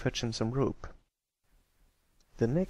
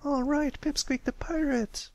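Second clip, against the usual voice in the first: much higher, bright cheer; excited cheer. Acted. Filly cheers the story's hero, excited. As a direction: cheer like a thrilled kid. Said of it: "he cheered excitedly".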